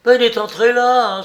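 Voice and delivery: loud high shout of realisation; crying out. A narrator's reading. Jacques realising he went in there, crying out. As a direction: shout it to the others, pitched right up. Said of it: "s'écria Jacques".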